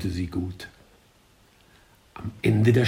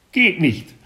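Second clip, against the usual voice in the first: higher, louder duck voice; quacking duck voice; 8 semitones higher, 5 dB louder than usual. A narrator's reading. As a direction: chatter it like a flustered duck. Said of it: "schnatterte sie".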